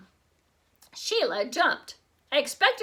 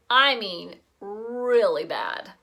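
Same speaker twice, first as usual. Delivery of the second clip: usual speaking voice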